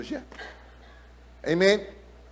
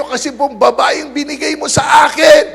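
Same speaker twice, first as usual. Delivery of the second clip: raised blaming shout, higher and louder; Adam loudly blames the woman God gave him; hammed-up loud excuse; mock shout; 7 semitones higher, 6 dB louder than usual. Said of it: "Sabi niya".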